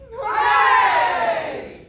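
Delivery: cheer. Acted. Hobbit cheers at news.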